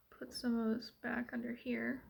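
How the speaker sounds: breathy whispered aside; whispering. Real, not acted. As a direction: whisper it to yourself. Unvoiced aside while applying.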